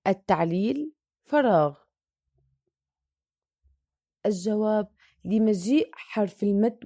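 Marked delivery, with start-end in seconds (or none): none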